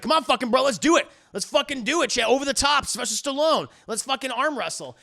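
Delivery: hammed-up arm-wrestling dare; hyped-up challenge. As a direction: egg him on like a rowdy bro. Acted erratic challenge to arm wrestle.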